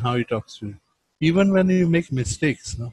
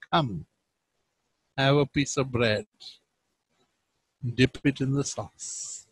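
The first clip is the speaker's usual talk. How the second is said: soft, gentle inviting voice, much softer; tender invitation; 3 semitones lower, 8 dB quieter than usual. Shown as a demonstration. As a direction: invite her kindly to share the bread. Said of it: "you can see the Last Supper being reenacted".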